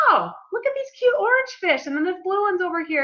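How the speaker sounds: high, bright-eyed pointing out fish; delighted wonder. Retold or imitated. Snorkeling, she points out fish in high delight. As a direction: gush like a kid at an aquarium. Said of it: "I was like".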